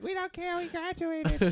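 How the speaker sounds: very high-pitched retort; mock defiant yell. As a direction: imitate a graduate calling back at the neighbours. Retold graduate yelling back at neighbours.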